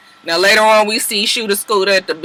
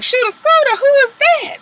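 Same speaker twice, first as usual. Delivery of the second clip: high put-on voice, dismissive; mock scornful question. Sierra brushing off Keely's boyfriend, high scornful mock voice. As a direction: ask it high as if the name means nothing.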